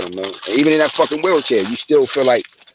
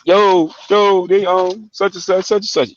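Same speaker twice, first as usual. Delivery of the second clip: put-on higher-pitched kid whining to his dad; 8 semitones higher, slower than usual. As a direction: play the little brother complaining, voice pushed up. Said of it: "go whining a little bit".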